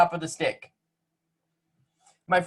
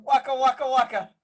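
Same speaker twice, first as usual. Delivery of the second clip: monster munch; 5 semitones higher than usual. Shown as a demonstration. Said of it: "[name], you're not doing it right".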